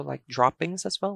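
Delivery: normal talking voice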